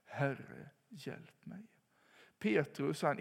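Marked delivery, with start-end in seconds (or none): 0.0-1.7 whispered hjälp mig, rising on mig; an unvoiced, pleading whisper; whispered plea. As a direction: viskad bön. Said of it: "kunna ropa".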